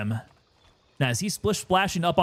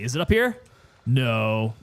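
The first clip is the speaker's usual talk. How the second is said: usual speaking voice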